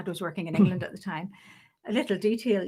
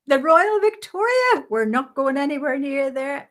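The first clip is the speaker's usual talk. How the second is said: indignant disbelief; 6 semitones higher, 10 dB louder than usual. Retold or imitated. UDA boss refusing the nurse a lift, loud and indignant. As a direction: shout back in outrage at the very idea. Boss's indignant reply in acted story.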